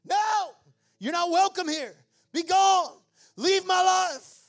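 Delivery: high, loud commanding rebuke of fear; shouted rebuke. Shown as a demonstration. Preacher shows the church how to rebuke fear, shouting. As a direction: drive the fear out of your life.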